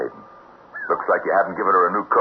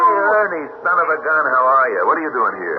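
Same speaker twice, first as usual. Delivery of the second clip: much higher and louder, hearty hello; excited greeting; 10 semitones higher, 3 dB louder than usual. Acted. Jason greeting his old partner Ernie, loud and delighted. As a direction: greet an old friend with a burst of joy.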